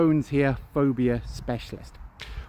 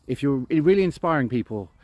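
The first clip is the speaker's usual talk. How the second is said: usual speaking voice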